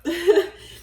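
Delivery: short, loud, high laugh; sudden laugh to break the emotion; emotional laugh. Real, not acted. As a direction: laugh through being choked up. Laughs while getting choked up.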